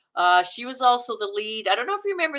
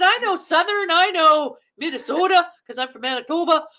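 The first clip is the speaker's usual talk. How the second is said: retold protest; 7 semitones higher, 4 dB louder than usual. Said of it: "I said".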